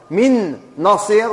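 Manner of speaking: من نصير said as "minnasir", slow, one long nasal nun; slow merged demo. Shown as a demonstration. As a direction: stretch "minnasir" into a single doubled nun. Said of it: "وش تولي؟". (من نصير بنون مشددة ممدودة)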